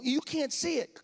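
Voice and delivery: normal talking voice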